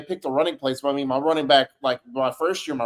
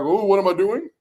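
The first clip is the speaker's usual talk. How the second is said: higher-pitched flustered voice; confused receiver; 6 semitones higher, 4 dB louder than usual. Acted. Football receiver rattled by crowd noise on the road, confused. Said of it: "confuse your receivers".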